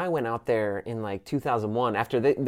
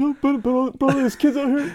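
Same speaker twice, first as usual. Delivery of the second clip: much higher, louder frantic Sal impression; freaking-out Sal; 6 dB louder than usual. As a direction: squeal it like Sal panicking. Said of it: "Sal was freaking out".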